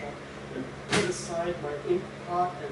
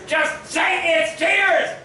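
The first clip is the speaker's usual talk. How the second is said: higher, loud, slow demanding yell; furious shout; 11 semitones higher, 10 dB louder, slower than usual. Acted. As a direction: scream the demand at him. Pleading, then shouting the same.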